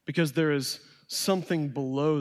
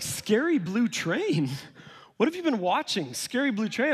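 incredulous; 6 semitones higher than usual. Retold or imitated. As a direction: scoff as if it can't be serious. Dad incredulous at son's fear.

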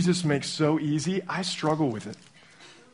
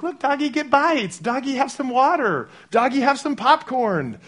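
put-on much higher, excited toddler voice; two-year-old son; 10 semitones higher than usual. Abel telling his stuffed dog to watch the rain, high and excited. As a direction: squeal like a little boy at the window. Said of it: "And he's like".

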